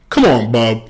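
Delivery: normal talking voice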